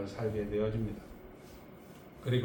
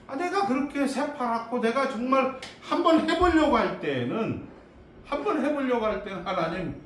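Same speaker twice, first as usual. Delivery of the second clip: high-pitched, aggrieved complaint; acted indignant protest; 7 semitones higher, 4 dB louder than usual. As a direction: protest to God as a put-upon Moses. 모세가 하나님께 따지는 연기. (억울한 듯 높은 소리로 따지듯)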